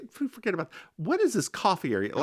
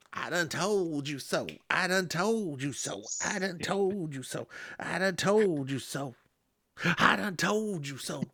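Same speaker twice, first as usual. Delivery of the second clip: repeated in a lightly put-on gloating voice, a touch softer; gloating I-told-you-so; 6 dB quieter than usual. Rio taunting Blackthorn, softer mock gloating chant. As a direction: chant it over and over like a smug security man. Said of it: "and Rio's like".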